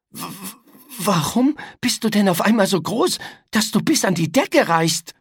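put-on a scared boy stumbling over his words; frightened stammer. A narrator's reading. Boy looks up at the giant creature, frightened stammer. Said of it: "erschrak und wich zurück".

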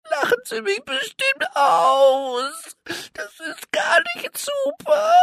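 very high, slow, crying complaint; tearful little elephant. A narrator's reading. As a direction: whimper it out like an upset small elephant.

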